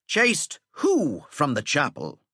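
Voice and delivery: irritated schoolmaster; dry impatient teacher. Acted. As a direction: needle the breathless boy. Teacher feigns ignorance.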